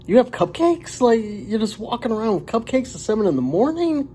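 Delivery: high, astonished questions; incredulous. Retold or imitated. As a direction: sound baffled that anyone carries cupcakes. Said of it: "I'm like".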